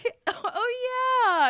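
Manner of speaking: breezy celebrity going along with it; Sarah Jessica Parker voice. Retold or imitated. Star humours the fan, high and breezy. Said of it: "And Sarah Jessica Parker goes".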